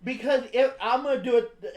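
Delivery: usual speaking voice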